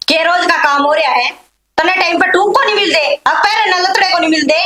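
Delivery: loud, indignant challenge; angry retort. Acted. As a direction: fire the questions back loudly in a family row. Acted family quarrel, angry retort. (गुस्से में ऊँची आवाज़ में तकरार)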